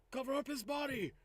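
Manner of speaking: commanding character. Acted. Player repeats order in character.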